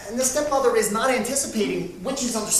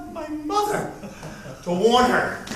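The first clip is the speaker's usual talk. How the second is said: laughing; 8 semitones higher than usual. Real, not acted. Laughing while telling.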